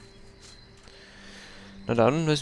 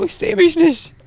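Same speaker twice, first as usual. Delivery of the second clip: much higher, louder confused whine; high panicked squeak; 9 dB louder, slower than usual. Real, not acted. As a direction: squeak in mock alarm. Kamera verloren. (hohe, panische Quietschstimme)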